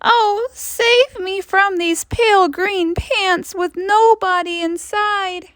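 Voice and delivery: high-pitched desperate plea for rescue; terrified cry. A narrator's reading. Narrator cries to be saved from the pants, terrified. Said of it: "I cried".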